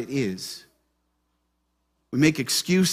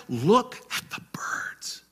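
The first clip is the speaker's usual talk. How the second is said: breathy whisper, slow; whispered quote; 3 semitones higher, 6 dB quieter, slower than usual. Acted. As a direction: hush it like a secret. Quoting Jesus, repeated line.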